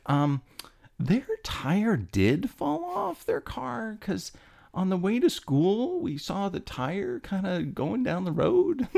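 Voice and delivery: high, slow, soft schoolgirl voice; sweet classmate voice. Retold or imitated. Classmate politely backing him up to the teacher, high, slow and soft. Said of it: "And she's like".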